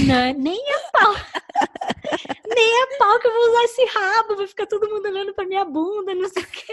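high, loud laughing protest through giggles; laughing refusal. Retold or imitated. To herself about the tail, laughing and high.

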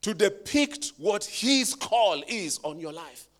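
loud soaring preacher voice; preaching at full force. Real, not acted. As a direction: preach it out, half singing. Preaching climax.

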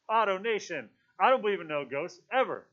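loud, high, emphatic denial; indignant. A narrator's reading. Piggy holds the conch and denies ghosts, indignant. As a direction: insist on it, hitting "ever" hard. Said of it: "His voice was indignant".

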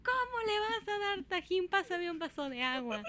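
high, drawn-out imitation of a shrieking mom; hammed-up motherly outrage, falling from a high start; outraged mother. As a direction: scold in mock horror, starting very high.

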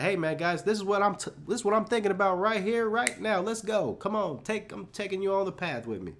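mock hype-man call to follow along; hyped-up rallying. Himself pitching his unplanned podcast to listeners, hammed-up hype. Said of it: "I'm just like".

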